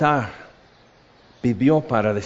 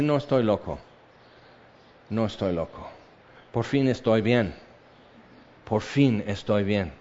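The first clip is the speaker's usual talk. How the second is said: calm denial; 5 semitones lower, 7 dB quieter than usual. Acted. Paul answering governor.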